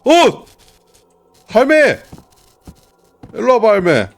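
loud excited shout. Real, not acted. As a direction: shout at the ghost. Scolding ghost grandma loudly.

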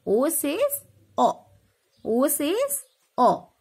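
slow, drawn-out O says O; slow model phrase. Shown as a demonstration. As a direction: model it slowly for the kids. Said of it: "Say children".

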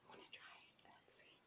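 hushed aside; whisper. Real, not acted. A prankster on a call, whispering aside. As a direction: whisper it to yourself.

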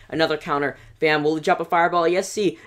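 ordinary talk

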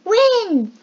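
high, loud cry of wind; excited call. A narrator's reading. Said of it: "said Snot".

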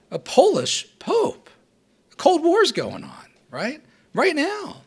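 high, incredulous voice as the stunned new pope; pope's shocked exclamation. Acted. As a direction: play the pope aghast at his own election. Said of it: "he said the Italian phrase".

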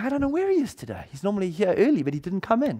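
high, light secretary voice; secretary's reply. Retold or imitated. Secretary quoted in story.

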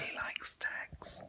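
breathy stage whisper. Pretend. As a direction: whisper it conspiratorially.

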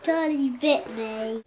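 slow, loud mock wail; viral quote. Friend quoting a viral video line, mock wail. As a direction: do the famous clip line. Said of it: "quoting the viral video".

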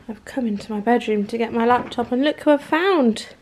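ordinary talk, nothing marked

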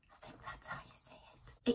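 hushed unsure aside; whispering. Real, not acted. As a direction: whisper it to yourself. Unsure aside about pronunciation.

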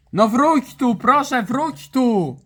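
high, drawn-out begging; pleading. Pretend. Calling a runaway to come back, pleading whine. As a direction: beg them back, stretching the words.

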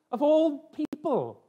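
high, slow, drawn-out astonishment; amazed disbelief. Retold or imitated. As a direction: stretch it out in happy disbelief. Retold amazement at being chosen.

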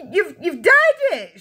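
stammered, high, loud shriek; stammer, then screech the accusation slowly. A narrator's reading. Mother accuses her husband of dyeing it, shrieking. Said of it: "shrieked the mother".

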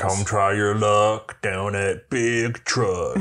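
slow, drawn-out dealership ad jingle line; mock advert slogan. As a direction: ham up a car-lot slogan, very slowly.

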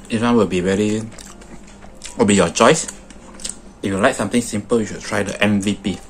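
chewing between words; talking while chewing. Real, not acted. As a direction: mouth partly full of food. Seen 3: Bulging cheeks, food-smeared lips throughout; bites just before and after.

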